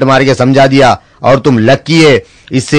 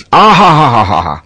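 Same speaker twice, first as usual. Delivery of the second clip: savoring laugh. Acted. Nawab savoring food.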